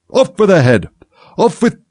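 high, loud imperious scream; screaming fury. A narrator's reading. Queen orders Alice's execution, screaming in fury. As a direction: scream the order, breaking off on the repeat. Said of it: "began screaming".

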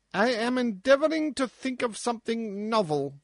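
high, distracted dwarf voice; abstracted dwarf. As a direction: answer absently, lost in thought. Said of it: "replied the dwarf abstractedly".

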